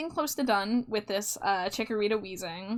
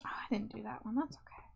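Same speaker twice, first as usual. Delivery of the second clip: whisper; 11 dB quieter than usual. Real, not acted. Quiet aside during stream.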